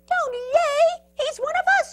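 very high, loud little protester; squeaky-high indignant shout; Pip yelling. A narrator's reading. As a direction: scold the crowd in a high yell. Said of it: "yelled Pip".

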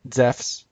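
Zephs, said zefs with an f; modern Greek pronunciation. Shown as a demonstration. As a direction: say Zephs as one syllable, zefs. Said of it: "modern Greek".

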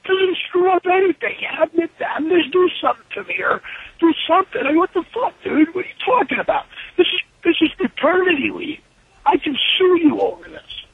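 high-pitched, indignant retold rant; incredulous pushback, acted out; exasperated arguing. Acted quarrel over paternity leave.

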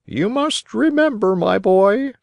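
very high bird voice; advising pigeon. A narrator's reading. Pigeon advising the boy, high-pitched. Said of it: "answered the pigeon".

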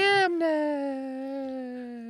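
high, very slow engine voice; slow train voice. Pretend. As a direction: drag it out like a tired engine. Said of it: "voice of the character".

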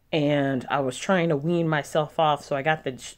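ordinary talk

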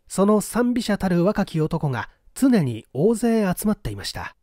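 usual speaking voice

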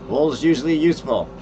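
usual speaking voice

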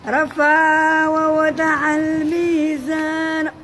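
slow, drawn-out chanted recitation; Quran recitation. Shown as a demonstration. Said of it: "انا ندير واحد المقطع".

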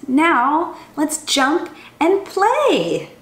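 lively, sing-song storybook reading; animated children's story voice. A narrator's reading. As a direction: read it like a chant for little kids. Reads a picture book aloud.

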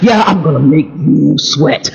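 suddenly deep, slow menacing voice; Tyler. Retold or imitated. Preacher imitating Tyler's sudden change, deep slow villain voice. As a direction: drop low and draw out the threat. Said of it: "that's exactly how he sounds".

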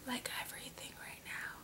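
breathless fast whisper. Real, not acted. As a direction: whisper it like a secret. Gushing about a lipstick.

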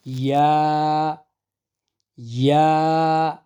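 slow ইয়া, tongue middle pressed to palate; Arabic ya. Shown as a demonstration. Teacher demonstrating ya, slow and pressed. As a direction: press hard and stretch ইয়া. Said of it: "জিহ্বার মাঝখানটা উপরের তালুর সাথে লাগিয়ে".

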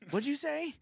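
put-on high startled child voice; startled kid. Kid startled by the whisper, high-voiced. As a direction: blurt it like a surprised kid.

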